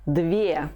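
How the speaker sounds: Dvie ending in a clear "ye". Shown as a demonstration. Teacher turns dva into the feminine form, Dvie ending in "ye". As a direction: say Dvie with "ye" as its last sound. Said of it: "say it with the ye at the end".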